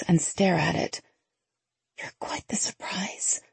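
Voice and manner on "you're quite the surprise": soft tender whisper. Acted. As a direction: whisper it gently to the photo. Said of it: "I whisper".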